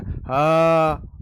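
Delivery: ह held long and loud with audible friction. Shown as a demonstration. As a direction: let the air rub through ह. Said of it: "घर्षण हो रहा है". (घर्षण के साथ लंबा ह)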